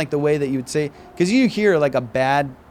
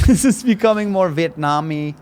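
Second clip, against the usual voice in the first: drifting accent with a laugh; laughing at himself; 4 semitones higher, 4 dB louder than usual. Shown as a demonstration. Admitting the accent slid Vietnamese, laughing. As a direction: break into a laugh mid-accent. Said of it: "This is more Vietnamese".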